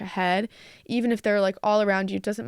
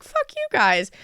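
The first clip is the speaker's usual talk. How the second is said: high, loud mock outburst at the squad; retold outburst; 9 semitones higher, 4 dB louder than usual. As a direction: throw it at the guys who sold you out. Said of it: "I was like".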